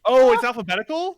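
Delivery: high, loud realization; dawning surprise; surprised. Real, not acted. Sudden realization.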